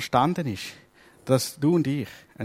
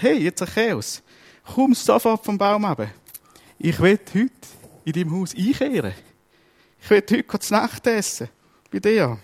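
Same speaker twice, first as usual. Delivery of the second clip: raised, eager call down from the tree; a lightly voiced, higher Jesus inviting himself over; 7 semitones higher than usual. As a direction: call him down warmly, pitched higher. Jesus calls Zachäus down.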